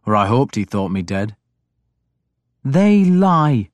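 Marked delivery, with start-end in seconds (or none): 2.6-3.7 "They" stressed, flat falling denial; flatly. A narrator's reading. Breda flatly denying the rumour to the king. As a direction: state it flatly, no heat. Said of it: "Breda said flatly".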